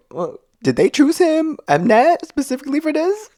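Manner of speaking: high, fast mock-baffled question; put-on high-pitched bewilderment; incredulous questioning. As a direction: squeak out a disbelieving question in a rush. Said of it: "And I'm like".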